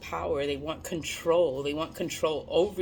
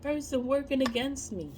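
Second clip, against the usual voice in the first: puzzled inner question; 6 semitones higher, 3 dB quieter than usual. Retold or imitated. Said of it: "And it's like".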